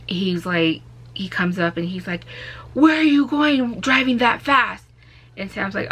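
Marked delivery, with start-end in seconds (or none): 2.7-4.9 stern officer. Retold or imitated. Officer voiced in retold traffic stop.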